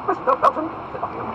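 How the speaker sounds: high, loud reprimand; ringing lordly command; outraged Duke. Acted. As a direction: call the officer to order. Duke orders officer's arrest.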